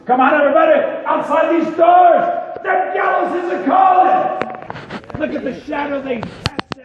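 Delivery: loud, high call to the crowd. Real, not acted. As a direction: summon the group outdoors. Guide calls the crowd outside.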